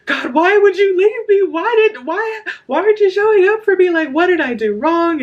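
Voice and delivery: high, loud, anguished pleading; retold tearful plea. Woman begging God not to leave her, retold crying plea. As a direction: re-enact a desperate wail, voice pushed way up. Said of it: "I was in tears and I was crying".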